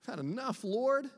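nasal-free high whine on enough and Lord; whiny voice. Retold or imitated. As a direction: whine it like a worn-out complainer. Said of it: "I think he's using his whiny voice".

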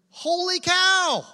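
high, loud, drawn-out whoop; mock exclamation. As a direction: exclaim it big and high for a laugh. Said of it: "they exclaimed".

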